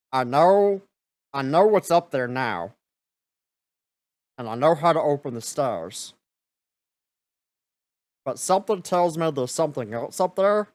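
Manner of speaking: put-on the old guardian unsure of what waits above; ice guardian character.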